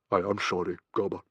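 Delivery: understated, grave condolence; solemn. A narrator's reading. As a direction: offer sympathy gravely to a comrade.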